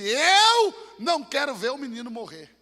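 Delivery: slow, loud, despairing cry; anguished cry. Acted. Hagar in the desert, crying out in loud despair. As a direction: cry it out like a mother losing her son.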